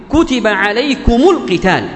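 slow recited "كتب عليكم القتال"; Quranic recitation. Shown as a demonstration. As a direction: intone the verse slowly. Quran verse cited in tajweed lesson. (تلاوة قرآنية متأنية)

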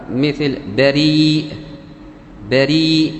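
بريء with the long ya held slowly before the hamza; drawn-out madd. Shown as a demonstration. Teacher showing the ya madd in بريء, stretched slowly. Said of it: "مد بالياء وبعد المد همزة".

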